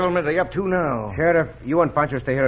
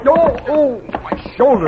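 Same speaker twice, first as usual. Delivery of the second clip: pained cry; 8 semitones higher, 5 dB louder, slower than usual. Acted. Hit by the hook.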